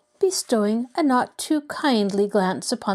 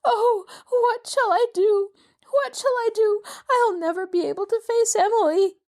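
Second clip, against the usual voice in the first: distraught; 13 semitones higher than usual. A narrator's reading. Mrs Willoughby pacing the dining room, distraught wailing. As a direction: wail in helpless despair. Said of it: "wringing her hands".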